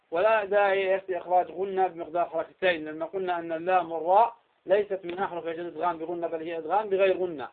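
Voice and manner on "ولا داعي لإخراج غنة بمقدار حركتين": ordinary talk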